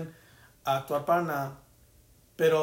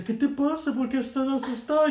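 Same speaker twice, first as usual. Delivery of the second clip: higher, louder voice as the grandma; a hint of puzzled grandma asking why; retold grandmother; 8 semitones higher, 4 dB louder than usual. Grandma asking the startled narrator what's wrong, higher voice. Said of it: "y ella".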